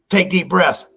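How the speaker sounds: calm instruction. Retold or imitated. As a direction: talk him through it. Friend calmly instructing first.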